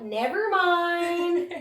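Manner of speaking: high, very drawn-out change of mind; comic retreat. Retold or imitated. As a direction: stretch it out, comically backing off. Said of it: "I was like".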